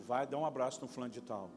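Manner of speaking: a quiet low voice urging him on; inner voice of God. Retold or imitated. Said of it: "Pela terceira vez a voz fala comigo".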